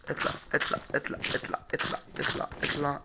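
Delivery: urgent whispered chant; tense whisper. Real, not acted. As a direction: whisper it under your breath, willing the climb to work. Urging himself up in game.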